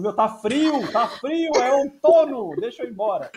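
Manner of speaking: high pleading whine; desperate whining. Retold or imitated. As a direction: beg to leave, whining. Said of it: "o Jason desesperado, falando".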